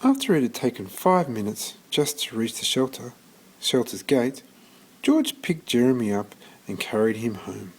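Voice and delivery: ordinary delivery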